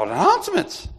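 high, strained outburst; mock dismay. Ray dreading doing the announcements, mock panicked blurt.

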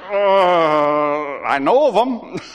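sheepish, laughing hesitation; awkward laughing. Retold or imitated. Answering the buddies who ask if he's his friend, laughing. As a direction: back away from him with an embarrassed chuckle. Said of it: "And I'm like".

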